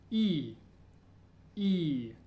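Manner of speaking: "E" said as a long ee vowel. Shown as a demonstration. Teacher modelling a new vowel letter, long ee. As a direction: hold "E" as the ee of me. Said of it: "E as in me".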